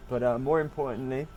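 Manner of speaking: ordinary delivery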